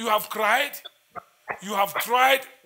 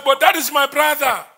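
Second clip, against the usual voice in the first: retold exclamation; 5 semitones higher, 8 dB louder than usual. Acts out the person's protest.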